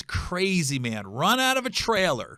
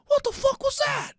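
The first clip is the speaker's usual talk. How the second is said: very high, mock-stunned muttered rant; dad talking to himself. Son imitating dad driving away from the trailer park, high-pitched disbelief. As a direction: squeal it in hammed-up disbelief, to nobody. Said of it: "He's talking to himself".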